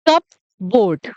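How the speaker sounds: "कप बोर्ड" as two words, P sounded; wrong pronunciation. Shown as a demonstration. Teacher showing learners the common mistake, mispronounced "कप बोर्ड" with audible P. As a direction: say "कप बोर्ड" with a clear P and full "board". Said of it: "ये गलत pronunciation है".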